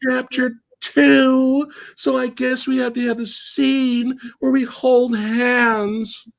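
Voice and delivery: put-on girl voice. Retelling two captive girls meeting.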